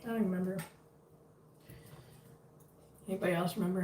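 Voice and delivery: normal talking voice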